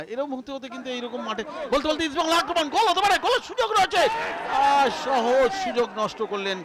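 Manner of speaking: high, loud yell at the chance, then dismay; frantic football commentary. Real, not acted. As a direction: call out the chance, groan at the miss.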